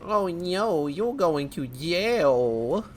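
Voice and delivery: drawn-out, mock-concerned silly voice; silly put-on voice. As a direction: stretch the words out in a goofy, play-acted voice. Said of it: "Why am I speaking like this".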